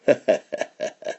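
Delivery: soft, unvoiced villain chuckle; laughing to himself. Outlaw looks at the rain outside, private chuckle. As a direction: chuckle slyly under the breath. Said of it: "laughed to himself".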